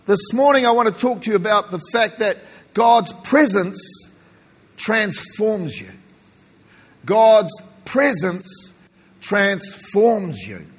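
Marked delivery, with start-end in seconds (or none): 2.7-10.6 each word weighed, very slow; slow and deliberate. Acted. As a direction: lay the key line down slowly. Slow emphatic key statement.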